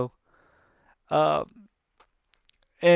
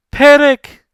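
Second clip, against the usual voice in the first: high, loud shouted name; angry yell; 11 semitones higher, 13 dB louder than usual. Retold or imitated. Hades yelling for his minion Panic, high and loud. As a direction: bellow the name.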